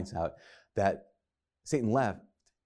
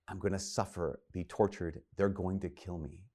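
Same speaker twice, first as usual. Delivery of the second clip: low, much softer quoted prophecy; hushed solemnity; 4 semitones lower, 8 dB quieter than usual. Retold or imitated. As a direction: drop down gravely, voicing a man foretelling his death. Jesus foretells his own death.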